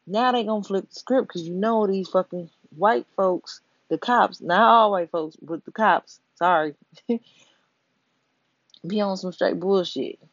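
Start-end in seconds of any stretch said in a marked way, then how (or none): none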